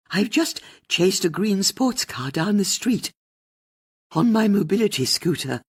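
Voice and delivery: put-on proud old lady after a chase; elderly caller telling the host about the chase; excited elderly caller. A narrator's reading. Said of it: "I'm out of breath".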